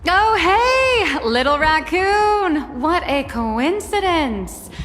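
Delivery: high, delighted, drawn-out hello; light up on seeing a friend; surprised greeting. Acted.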